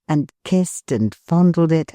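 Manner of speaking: ordinary delivery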